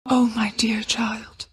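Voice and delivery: slow, hushed, near-whispered sigh; soft, drawn-out murmur of sympathy; tender sympathy. Acted. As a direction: breathe it out softly and slowly, with gentle sorrow. Tender, sorrowful moment of revelation.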